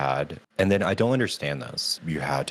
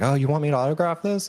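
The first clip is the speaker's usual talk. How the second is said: quick, higher, louder mock offer; imitating the actor; 10 semitones higher, 4 dB louder, faster than usual. Fan mimics Sam offering to sign her poster, high and fast. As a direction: rattle it off high and fast like a celebrity. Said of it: "And he's like".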